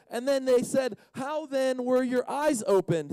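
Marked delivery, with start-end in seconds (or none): 1.1-3.1 high, slow demanding question. Acted. Neighbours questioning the healed man, demanding. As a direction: draw out a mildly hammed-up demand. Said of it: "they demanded".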